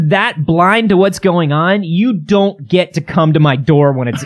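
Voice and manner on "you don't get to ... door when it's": normal talking voice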